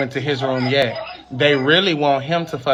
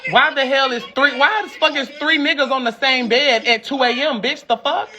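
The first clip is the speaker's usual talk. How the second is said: mock shrill yell; 11 semitones higher than usual. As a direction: shriek at them in a hammed-up high voice, laughing. Yelling at people in video.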